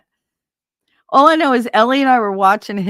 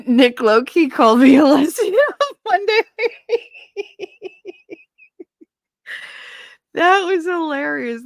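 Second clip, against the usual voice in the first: laughing; 12 semitones higher, 5 dB louder than usual. Real, not acted. Laughing at a funny memory.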